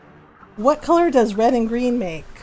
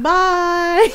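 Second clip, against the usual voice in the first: high, loud sing-out farewell; teasing goodbye; 7 semitones higher, 5 dB louder than usual. Retold or imitated. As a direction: playfully call it back to tease. Mom teasingly answers annoyed daughter's goodbye.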